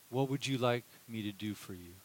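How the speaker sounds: soft, low and gentle; tender question. Retold or imitated. Jesus asking blind beggar what he wants, tender. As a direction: offer help kindly.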